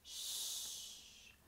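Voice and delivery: softly hissed "ch"; just breathe out "ch"; hushed ch. Shown as a demonstration. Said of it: "ça fait".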